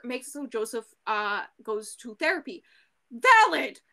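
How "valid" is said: loud, emphatic cry of approval, higher; excited shout. Real, not acted. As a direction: shout "Valid!" as a loud, punchy verdict.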